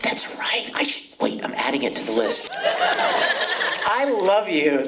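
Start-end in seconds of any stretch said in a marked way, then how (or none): 2.5-4.9 I love you stretched very slowly and higher, with a laugh; drawn-out I love you. Real, not acted. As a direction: draw out every word of I love you fondly. Tender stretched reply to fan.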